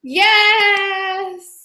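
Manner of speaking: high, drawn-out singsong yes; excited cheer. Real, not acted. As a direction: stretch it out in a high, delighted cheer.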